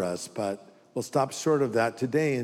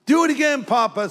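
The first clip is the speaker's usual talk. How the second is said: put-on much higher, louder eager little kid; imitating a small child; 10 semitones higher, 4 dB louder than usual. Grandkid begging grandpa to play again, eager child voice. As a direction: beg like a delighted small child.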